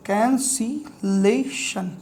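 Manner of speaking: Cancellation very slowly, softly, broken into syllables. Shown as a demonstration. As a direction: sound out cancellation as can, si, lay, shun with gaps. Teacher sounds term out while writing.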